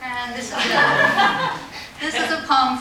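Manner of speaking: breaking into a loud laugh mid-introduction; laugh, then pick the sentence back up. Real, not acted. Poet introducing a tribute poem, laughing.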